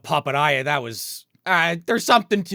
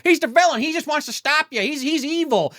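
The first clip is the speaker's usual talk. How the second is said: high, agitated dummy squawk; pitched way up, insistent; puppet voice; 12 semitones higher, 3 dB louder than usual. Retold or imitated. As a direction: imitate a puppet accusing someone. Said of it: "like, as the puppet".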